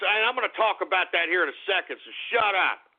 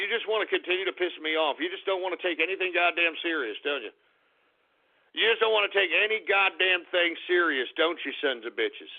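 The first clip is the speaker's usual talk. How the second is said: usual speaking voice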